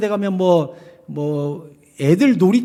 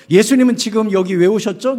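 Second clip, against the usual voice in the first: ordinary talk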